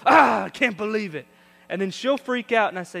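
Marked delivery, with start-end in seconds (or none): 0.1-1.3 loud fake groan of regret; mock dismay. Son fooling mom on road trip, loud mock dismay. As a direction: ham up forgetting it. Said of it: "I'll go".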